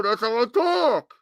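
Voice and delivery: words slurred together, hard to understand; mumbled voice. Pretend. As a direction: mumble it like a mouthful. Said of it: "Oh, that's how you talk".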